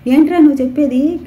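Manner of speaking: shocked shout, high and loud. Acted. Brother shouts at the milkman, shocked and loud. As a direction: bark the question in disbelief. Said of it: "గట్టిగా అరుస్తూ అడిగాడు".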